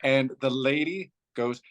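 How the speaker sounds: ordinary delivery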